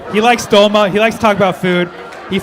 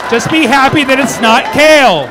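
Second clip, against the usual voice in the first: louder, higher jab at the crowd; teasing crowd shout; playful shout; 6 semitones higher, 6 dB louder than usual. Acted. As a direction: bark it out for laughs.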